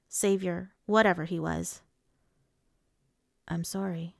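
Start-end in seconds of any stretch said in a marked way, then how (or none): 3.5-4.2 soft, hushed condolence; sorry said gently, barely above a murmur; quiet apology. A narrator's reading. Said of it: "he said quietly".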